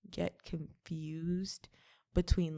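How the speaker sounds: ordinary delivery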